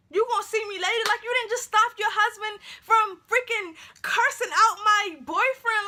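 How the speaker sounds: high, loud, outraged retort; acted indignation. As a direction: throw it back at mom in disbelief. Retold indignant reply to mom.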